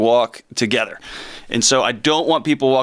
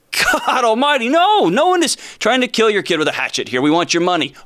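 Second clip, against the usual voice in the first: exasperated retort; 7 semitones higher than usual. Retold or imitated. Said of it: "And I would say".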